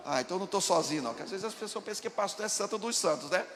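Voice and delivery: usual speaking voice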